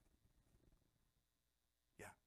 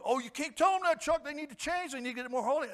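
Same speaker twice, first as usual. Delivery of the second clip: imagined objector; 10 semitones higher, 8 dB louder than usual. Pretend.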